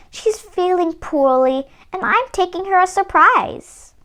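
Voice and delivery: put-on a bright little girl chattering about granny; a hint of a young girl's voice.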